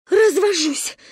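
high, indignant exclamation; gasped outburst. A narrator's reading. Mother pulled from the water, indignant outburst. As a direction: burst it out on catching breath. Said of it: "выдохнула мама".

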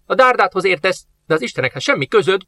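lightly voiced indignant old master; old master rebuking. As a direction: scold the soldier as a proud old man.

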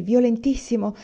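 plain reading voice

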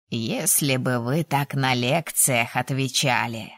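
put-on low, slow old teacher's grumble; good-natured grumble. A narrator's reading. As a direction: grumble slowly with a smile underneath. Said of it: "ворчливо заметил артефактор, но с улыбкой". (ворчливо, но с улыбкой, низко и медленно)

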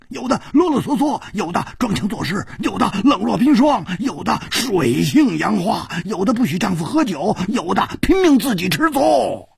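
high, loud, hearty teasing; boisterous Hu Tiehua. A narrator's reading. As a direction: rib his friend with gusto. Hu Tiehua listing flaws.

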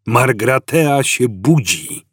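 slow, solemn declaration; old man revealing the awakening; solemn announcement. A narrator's reading. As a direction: let each word land with weight.

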